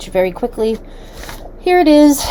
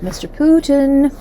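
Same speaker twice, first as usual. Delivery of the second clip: higher, slower, louder call of "Mister Putin"; drawn-out teasing "Mister Putin", pitched up; mock call-out; 6 semitones higher, 4 dB louder than usual. Shown as a demonstration. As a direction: sing out "Mister Putin" like calling a naughty child.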